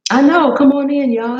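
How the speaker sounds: calling viewers in. Real, not acted. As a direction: wave them in with your voice. Inviting viewers again.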